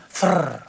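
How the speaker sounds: imitated spinning toilet-paper roll; trilled whirring noise; toilet roll rattle. Husband imitating the bathroom noise, rolling trill. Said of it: "It's the noise you can hear".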